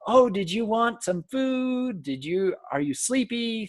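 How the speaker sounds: high, slow happy coo; happy baby talk. Shown as a demonstration. Lecturer showing how to answer baby noises, high and slow. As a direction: chat happily with a babbling baby. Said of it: "Act happy. Say".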